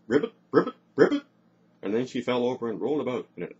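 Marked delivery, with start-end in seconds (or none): 0.1-1.2 quick repeated frog croaks; mocking frog. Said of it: "pretending to be a frog".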